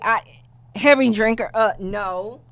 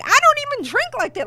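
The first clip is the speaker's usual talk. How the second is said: incredulous; faster than usual. Retold or imitated. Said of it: "So I'm like".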